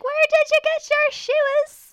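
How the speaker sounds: loud, high drawn-out squeal; gushing stranger. Retold or imitated. Drunk girl admiring a stranger's shoes, squealed gushing.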